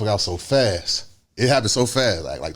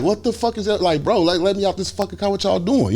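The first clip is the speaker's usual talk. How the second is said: high-pitched panicked protest; retold panic; 10 semitones higher than usual. Passenger demanding out of the car, high panic. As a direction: re-enact begging to be let out, voice pitched high. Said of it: "now it's panic sweat".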